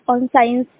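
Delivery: ordinary talk, nothing marked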